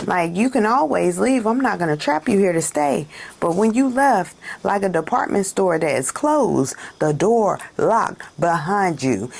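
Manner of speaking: normal talking voice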